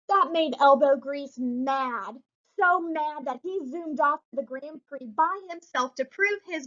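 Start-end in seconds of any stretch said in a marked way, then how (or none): none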